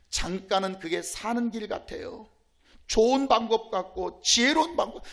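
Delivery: usual speaking voice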